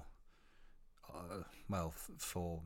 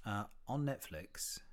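usual speaking voice

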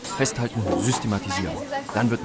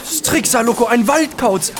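much higher and louder, thrilled; excited exclaiming; 13 semitones higher, 6 dB louder, slower than usual. Acted. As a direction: blurt out the discovery with delight. Scientist spots the owl.

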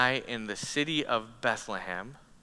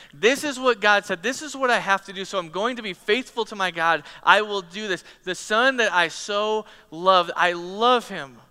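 loud, high, resolute vow of a torn father; determined father; 6 semitones higher, 6 dB louder than usual. Acted. Preacher voicing Abraham resolved to obey God, loud. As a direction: insist on it with rising force. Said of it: "But Abraham is determined".

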